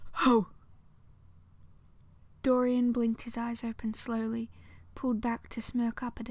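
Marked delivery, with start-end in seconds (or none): 0.1-0.5 sudden loud burst of surprise; startled exclamation. A narrator's reading. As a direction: blurt it out, caught off guard. Sudden outburst mid-act.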